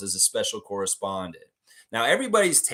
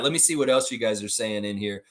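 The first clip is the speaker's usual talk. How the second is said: ordinary talk, nothing marked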